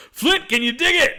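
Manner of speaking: high, hammed-up hype call; mock showman call. Retelling Jackie taunting the bear, hammed-up showman call. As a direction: call it out like a cocky wrestler. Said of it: "turns his back and says".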